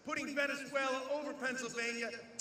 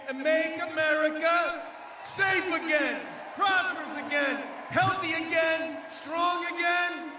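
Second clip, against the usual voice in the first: rally shout; 5 semitones higher, 7 dB louder than usual. Real, not acted. Rally chant list.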